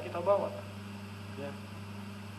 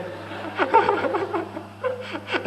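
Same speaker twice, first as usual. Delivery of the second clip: squeaky high-pitched laughter; laughing at own remark. Real, not acted. Speaker laughs.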